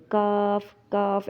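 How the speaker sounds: کاف said light, as people wrongly read both letters; thin kaf. Shown as a demonstration. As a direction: keep both کاف thin, without any heaviness. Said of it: "اکثر لوگ ان دونوں کو باریک کاف پڑھ دیتے ہیں". (کاف باریک پڑھیں)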